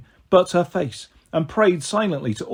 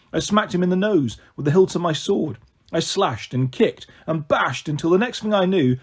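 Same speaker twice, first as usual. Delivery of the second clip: ordinary delivery, nothing marked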